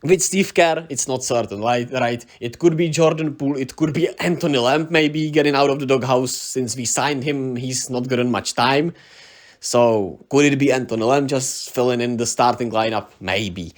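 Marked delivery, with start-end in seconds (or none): none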